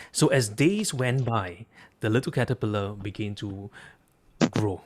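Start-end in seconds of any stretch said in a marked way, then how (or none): none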